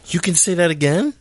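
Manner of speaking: high, incredulous rise on "again"; exaggerated question, voice climbing on "again". Shown as a demonstration. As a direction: make it an astonished question, "again" way up. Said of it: "as a question".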